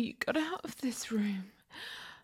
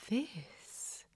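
ordinary delivery

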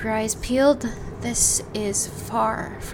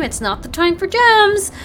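louder and higher, scolding; frustrated outburst at a partner grabbing gems; exasperated raised voice; 8 semitones higher, 6 dB louder than usual. Acted. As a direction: snap at them to stop grabbing gems.

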